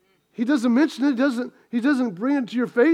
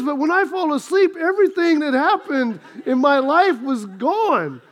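ordinary talk, nothing marked

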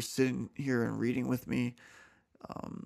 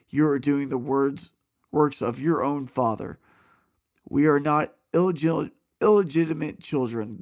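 clear, louder, steady Bible reading voice; scripture reading; 2 semitones higher, 8 dB louder than usual. A narrator's reading. Reading John aloud to listeners, earnest scripture voice. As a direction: read the passage out with conviction.

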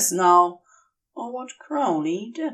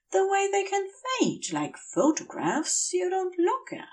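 Aziraphale voice; 8 semitones higher than usual. Acted. Aziraphale dreamy recollection, higher.

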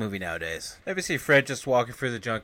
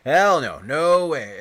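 loud, higher mock refusal; emphatic refusal; 4 semitones higher, 8 dB louder, slower than usual. Cab driver refuses the injured man a ride, emphatic. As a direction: act out a loud, flat-out no.

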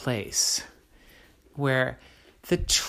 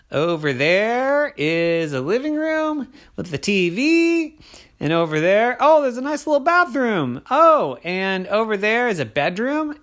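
excited; 6 semitones higher than usual. Acted. Ollie exploring the cave.

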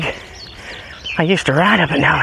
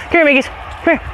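high dog call; 9 semitones higher, much faster than usual. Real, not acted. Calling dog.

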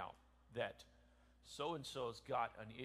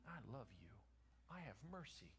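soft, low and gentle; tender mercy; 3 semitones lower, 11 dB quieter, faster than usual. Acted. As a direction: say it softly, like a quiet promise.

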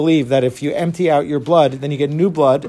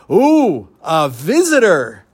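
put-on higher, mischievous little-boy voice; gleeful little boy; 6 semitones higher than usual. Little boy spots a visitor to be mean to, gleeful. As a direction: relish spotting someone to pick on. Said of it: "this little boy sees him and says".

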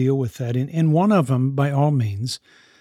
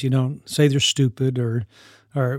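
normal talking voice

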